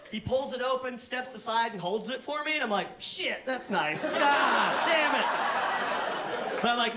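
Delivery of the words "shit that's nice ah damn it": slow, laughing grudging thanks; grudging surprise. Retold or imitated. Comic thrown when the door is held, laughing grudgingly. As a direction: admit it through a laugh. Disarmed by kindness.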